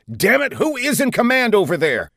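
high-pitched angry demand; exasperated outburst. A narrator's reading. Commander demanding who leads the silent squadron, exasperated. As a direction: burst out in frustration.

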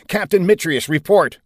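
urgent demand. A narrator's reading. Commander calling his fighter captain, urgent. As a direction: demand an answer over the radio.

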